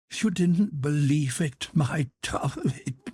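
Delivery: amused and moved at once; tender laugh. A narrator's reading. Mother to son, slow tender laugh.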